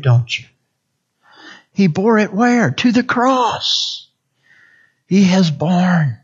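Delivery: ordinary delivery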